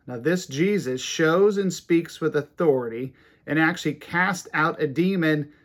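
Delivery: usual speaking voice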